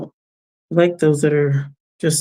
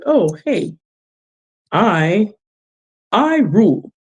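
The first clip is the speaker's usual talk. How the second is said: higher, swaggering mock brag; mocking boast; 4 semitones higher, slower than usual. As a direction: imitate a smug show-off, pitch swooping up. Mocking people who boast.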